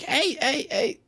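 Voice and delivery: high-pitched mock scolding; imitated lunch lady. Lunch lady who has had enough, high mock protest.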